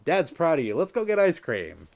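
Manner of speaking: dad voice. Pretend. Streamer playing dad praising his kid, hammed-up proud dad voice. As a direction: play the proud dad offering a treat. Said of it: "That's my dad voice".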